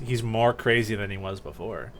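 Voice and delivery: normal talking voice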